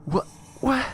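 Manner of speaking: mock bewildered squawk; baffled boy. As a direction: play a stunned kid.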